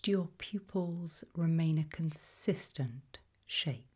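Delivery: normal talking voice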